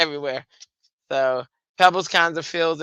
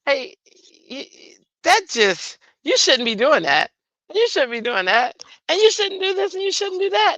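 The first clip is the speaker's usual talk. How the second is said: higher, put-on scolding voice; scolding Melissa; 12 semitones higher than usual. Imitating Melissa scolding Drea, pitched up. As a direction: wag a finger as you list every don't. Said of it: "Melissa be like".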